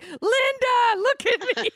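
high shouted cat voice; squeaky begging cartoon cat. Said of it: "all the cats are like".

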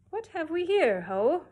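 loud, high kingly challenge; royal demand. Acted. King spotting the dancing cow, loud demand. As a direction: call it out like a startled king. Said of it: "he demanded".

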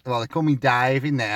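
normal talking voice

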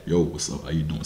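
very low, soft deep-voiced greeting. Shown as a demonstration. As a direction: drop into a deep, heavy voice. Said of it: "With my deep voice".

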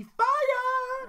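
high, drawn-out playful wail; hyped-up cry. Real, not acted. Host hyping the pack with a fire pun, high drawn-out cry. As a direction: stretch FIRE up into a high holler. Punning hype on fire energy card.

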